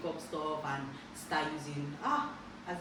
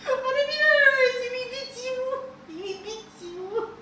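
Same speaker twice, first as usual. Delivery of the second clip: high animated telling; 11 dB louder than usual. Real, not acted. Recounting dad's beatings, animated.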